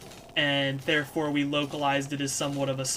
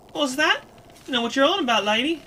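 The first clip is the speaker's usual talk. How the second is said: high, loud feigned confusion; playing dumb; 8 semitones higher, 7 dB louder than usual. Kid denying all to the crone, playing dumb. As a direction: act clueless in a brash youngster's voice. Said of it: "Kid did her best to play Dom".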